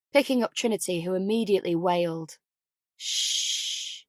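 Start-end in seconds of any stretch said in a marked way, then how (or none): 3.0-4.0 soft unvoiced shush; calm the baby with a hush. A narrator's reading. Mother hushing her wailing baby, soft.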